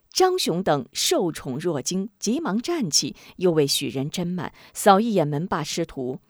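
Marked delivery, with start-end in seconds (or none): none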